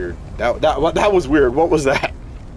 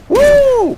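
loud, high cheering whoop; 7 dB louder than usual. Real, not acted. Gamer cheering his clones on, high whoop. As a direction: let out a wild whoop.

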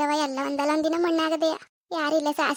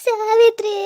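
louder, higher cry of the mother's name; anguished call; 4 semitones higher, 7 dB louder, much slower than usual. Acted. Daughter crying out to her dead mother, louder and higher.

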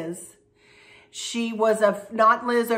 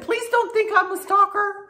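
pleading friend; 11 semitones higher than usual. Retold or imitated. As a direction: beg it like a sweet embarrassed friend. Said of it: "And she said".

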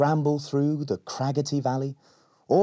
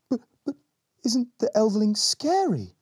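nervous stammer; 12 semitones higher than usual. Acted. Scared Berry stammers.

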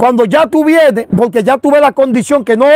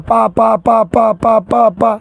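steady run of "pa" syllables; evenly chanted mock sound effect, tailing off; imitated prayer strikes; 3 semitones lower, slower than usual. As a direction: rattle off pa-pa-pa to mimic laying on hands. Imitates repeated praying over someone.

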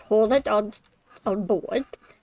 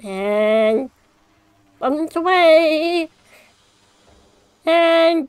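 high, loud cheer; excited; 7 semitones higher, 8 dB louder, much slower than usual. Real, not acted. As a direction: whoop at the big catch. Monster catch, cheering.

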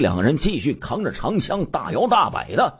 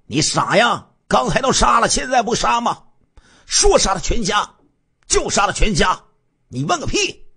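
scornful scolding; 9 semitones higher than usual. Acted. Gang leader scolds his accomplice.

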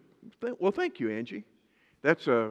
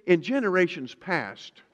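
usual speaking voice

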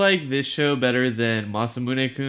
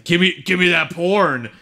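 higher, louder mock demand; greedy demand; 8 semitones higher, 6 dB louder than usual. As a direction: act out an eager, grabby character. Acting out Sagiri demanding the porn.

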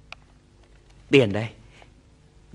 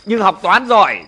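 ordinary delivery

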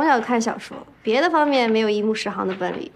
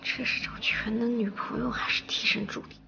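whispered grumble, very soft; barely voiced private complaint; muttered aside; 11 dB quieter than usual. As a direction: grumble to yourself under your breath. Grumbling to herself under her breath.

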